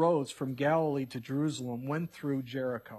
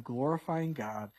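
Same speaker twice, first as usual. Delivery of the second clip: normal talking voice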